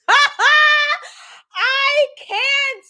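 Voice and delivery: high, incredulous exclamation; can't believe him, voice shooting up; exasperated disbelief. Real, not acted. Telling viewers she can't believe his response.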